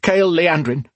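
high, hurried confession; frightened blurt. A narrator's reading. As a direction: blurt the name out in fear. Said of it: "the man blurted".

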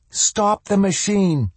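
high, urgent, slowed yell; shouting. A narrator's reading. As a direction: scream it out in desperate urgency. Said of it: "She shouted".

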